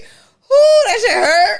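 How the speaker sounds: high, loud laughing whoop; laughing shout. Real, not acted. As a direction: howl it through laughter. Recalling first friend argument.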